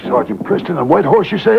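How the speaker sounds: startled, pitch jumping up on the name; surprised. Acted. Gang boss hearing a Mountie is in town, startled. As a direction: caught off guard by the name Preston.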